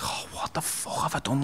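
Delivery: breathy, hushed self-reproach; despairing whisper. Retold or imitated. Son realizing what he did, whispering in despair. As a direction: whisper it in shock at yourself. Realizing he hurt his mother.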